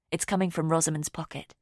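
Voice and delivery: ordinary talk, nothing marked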